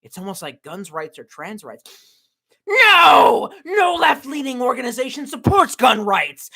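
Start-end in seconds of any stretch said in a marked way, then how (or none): 2.7-6.5 shouting down a rival argument; outraged shout. Acted. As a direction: bellow it in mock outrage. Outraged retort to own sarcasm.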